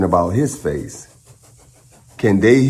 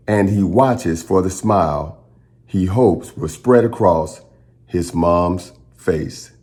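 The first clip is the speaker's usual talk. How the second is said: usual speaking voice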